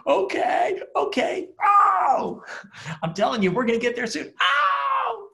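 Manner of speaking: very high, loud comic yelps and pleading; old man on the porch shouting ow between brave reassurances; husband yelping. Retold or imitated. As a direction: keep yelping while gamely agreeing. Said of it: "And he's like".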